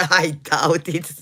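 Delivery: amused disbelief through laughter; laughing. Real, not acted. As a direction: chuckle through a skeptical reply. Laughing at Boston accent claim.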